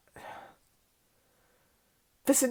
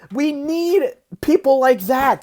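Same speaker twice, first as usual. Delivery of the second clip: normal talking voice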